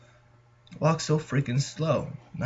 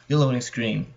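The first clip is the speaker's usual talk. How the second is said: normal talking voice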